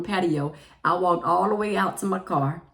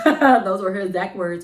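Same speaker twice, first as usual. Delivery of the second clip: laughing; 2 semitones higher, 8 dB louder than usual. Real, not acted. Laughing at quote.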